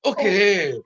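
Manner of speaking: high Scottish Billy Connolly voice; lightly hammed-up Glaswegian comedian. Asked on air for a wee Scottish accent, playful Billy Connolly impression. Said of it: "I could do a Billy Connolly".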